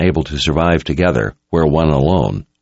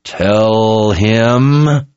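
drawn-out, higher growl; growled order; 4 semitones higher than usual. A narrator's reading. Kara presses the captive for answers, drawn-out growl. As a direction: growl it, stretching the words out. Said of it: "she growled".

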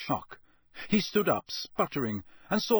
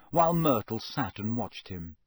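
usual speaking voice

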